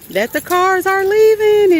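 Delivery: excited moving-day reveal. Real, not acted. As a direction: squeal it out with delight. Showing cars being picked up.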